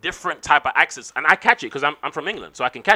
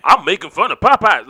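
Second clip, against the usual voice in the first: louder, higher caricature voice; 3 semitones higher, 8 dB louder than usual. Acted. Actor mocking his own early attempt, loud caricature voice. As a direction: push it into a loud, exaggerated caricature. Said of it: "coonish sounding whatever".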